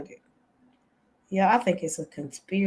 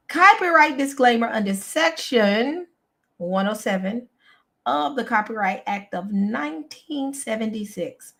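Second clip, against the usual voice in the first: formal reading; 4 semitones higher than usual. Acted. Reads legal disclaimer aloud.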